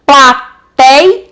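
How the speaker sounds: "Plateia" split as pla... tei... a; "Plateia" in three separate, emphatic beats; syllable by syllable. Shown as a demonstration. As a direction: say "Plateia" with gaps between syllables, leaning on tei. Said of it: "é a penúltima e não a última".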